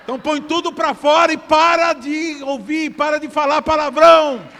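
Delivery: loud, high exhortation from the pulpit; full-force preaching. Real, not acted. Preacher rebuking swearing in church, shouted preaching.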